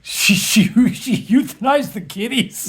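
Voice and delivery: high, laughing, shocked question; real shocked laughter at the grim news; laughing disbelief. Real, not acted. Shocked at grim family story.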